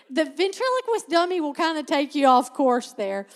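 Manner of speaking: can't stop laughing while saying it; words squeezed out through real laughter. Real, not acted. Laughing at her own tangent.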